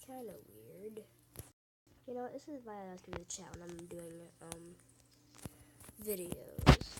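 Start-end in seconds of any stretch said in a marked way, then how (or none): none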